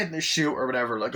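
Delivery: normal talking voice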